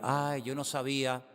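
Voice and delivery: slow, whiny "ay" played high and soft; mock excuse. Preacher mocking someone caught unprepared, whiny and soft. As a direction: mimic a lame excuse, drawn out.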